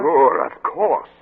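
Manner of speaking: slow, loud dawning shock; stretched-out loud revelation; excited realization. Acted. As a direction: hit it as the truth lands. Realizes the phrase's meaning.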